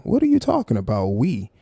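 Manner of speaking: normal talking voice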